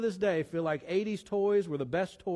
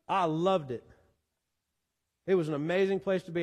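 usual speaking voice

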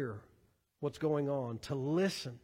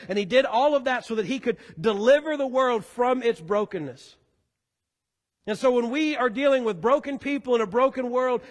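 louder, higher sermon voice, driving each clause; full-force preaching; 5 semitones higher, 7 dB louder than usual. Acted. Preacher proclaiming the gospel to the church, loud and rising. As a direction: pastor to the congregation, swelling into full preaching.

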